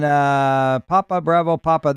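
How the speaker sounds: ordinary talk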